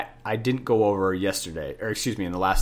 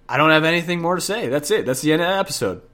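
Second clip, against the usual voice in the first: quick, louder fake ending to the episode; mock sign-off; 6 semitones higher, 4 dB louder, faster than usual. Teacher teasing listeners with a fake sign-off, playful. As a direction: pretend the show is over, higher and brisk. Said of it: "No, I'm just kidding".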